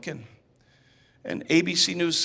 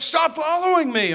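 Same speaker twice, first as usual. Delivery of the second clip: higher, louder mock shout; retold yell; 10 semitones higher, 6 dB louder than usual. Fleeing friend yelling at his chaser, mock shout. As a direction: call back over the shoulder to make him stop.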